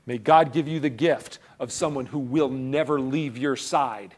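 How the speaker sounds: usual speaking voice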